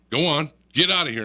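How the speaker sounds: re-enacted loud order; shooing a bear. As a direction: shoo it off like a stray dog. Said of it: "I looked right at him and I says".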